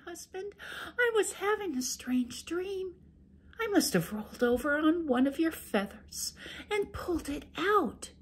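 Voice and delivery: high sweet wife making excuses. Acted. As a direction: play innocent to the ogre, high and gentle.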